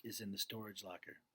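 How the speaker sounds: low, quiet surrender; father finally agreeing under his breath; quiet giving in. A narrator's reading. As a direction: concede quietly, worn down. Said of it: "he says quietly".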